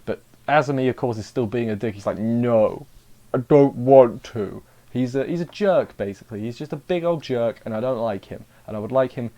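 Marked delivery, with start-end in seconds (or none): 2.2-4.6 slow, drawn-out refusal, punched louder; mock stubborn refusal. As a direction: imitate someone digging their heels in. Mimics stubborn character refusing.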